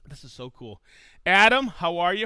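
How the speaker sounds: normal talking voice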